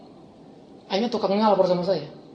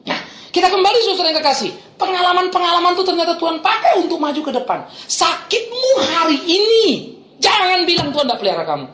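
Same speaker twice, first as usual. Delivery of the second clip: high, loud pulpit proclamation; full-force preaching; 11 semitones higher, 4 dB louder than usual. Acted. Preacher assuring the congregation of God's care, impassioned preaching. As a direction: preach it out with rising fervour.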